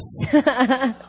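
short burst of laughter; real, spontaneous chuckle; amused laugh. Real, not acted. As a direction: laugh at the congregation's response. Amused by shouted response.

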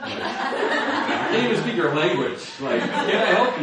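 loud, slow, laughing over his own words; slow laughing mock plea; laughing self-mockery. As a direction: laugh at how naive it sounds. Mocking his own naive email.

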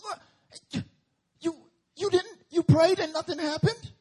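high, loud frustrated yell; Jesus shouting at his disciples; scolding shout. Retold or imitated.

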